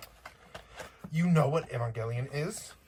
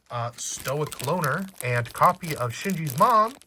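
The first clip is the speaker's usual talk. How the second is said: normal talking voice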